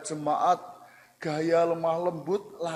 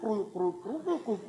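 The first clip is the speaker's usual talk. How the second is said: soft, quick gurgling with pitch jumping up; imitated stomach rumble; 2 semitones higher, 8 dB quieter, slower than usual. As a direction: mimic a hungry belly growling, quietly and playfully.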